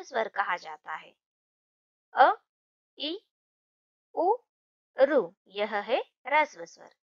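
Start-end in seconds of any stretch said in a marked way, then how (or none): 2.1-5.2 अ इ उ ऋ each said short and crisp; short vowels, clipped. Shown as a demonstration. Teacher to class, short vowels said crisply one at a time. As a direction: say अ इ उ ऋ quickly, one by one. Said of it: "जिन स्वरों का उच्चारण करने के लिए कम समय लगता है".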